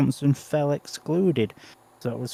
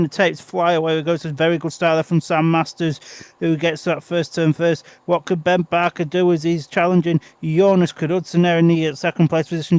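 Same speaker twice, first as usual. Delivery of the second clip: rising excited race commentary; live play-by-play; 4 semitones higher, 4 dB louder than usual. Acted. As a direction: call the race live as it unfolds. Live speedway race call.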